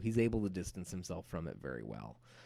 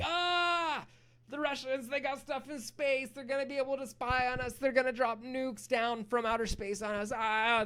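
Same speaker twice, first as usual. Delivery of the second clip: much higher, hammed-up frantic panic; mock panic. Teacher voicing Americans freaking out over Sputnik, hammed-up high panic. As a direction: squeal it out like a whole country losing its mind. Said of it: "they're freaking out".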